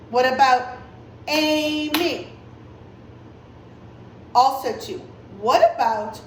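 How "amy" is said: "Amy" stretched into two even beats, A-my; teacher drawing out each half of "Amy"; clapped-out syllables. Shown as a demonstration. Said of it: "we clapped for our syllables".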